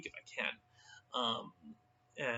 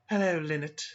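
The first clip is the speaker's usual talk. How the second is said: higher, lightly mocking young woman; Jacqueline's voice; 4 semitones higher, 4 dB louder than usual. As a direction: greet them with a sly edge. Jacqueline greets the couple.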